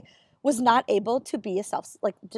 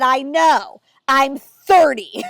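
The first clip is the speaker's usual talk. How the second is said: louder, slow, pointed comeback; deadpan retort; 7 dB louder, much slower than usual. Retold or imitated. Answering the condescending dermatologist, slow pointed retort. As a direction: land the punchline slowly and firmly. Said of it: "And I said".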